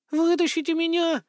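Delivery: very high, thin-distance cry for help; calling from underground. A narrator's reading. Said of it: "раздался голос пропажи откуда-то из-под земли".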